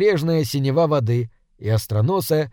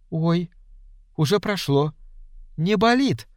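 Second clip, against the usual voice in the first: gasping surprise; 3 semitones higher, 3 dB quieter than usual. A narrator's reading. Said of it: "ахнула Леночка".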